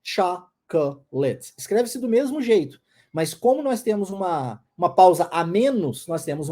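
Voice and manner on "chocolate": "chocolate" said slowly as three English syllables, CHA-ko-let; "chocolate" in three slow English beats; slow English "chocolate" with short pauses between the beats. Shown as a demonstration. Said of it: "Em inglês, chocolate".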